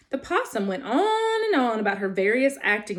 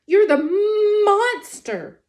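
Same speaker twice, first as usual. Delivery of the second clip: high, loud alarmed cry; frightened; 10 semitones higher, 7 dB louder, slower than usual. Acted. Possum recognizes the robot, alarmed. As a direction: back away calling out in alarm.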